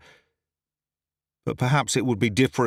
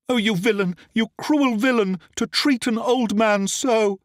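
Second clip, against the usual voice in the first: acted fury; 10 semitones higher than usual. A narrator's reading. The lady feigns grief and fury at the captive, high outraged wail. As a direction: heap scorn on the villain. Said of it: "she cried".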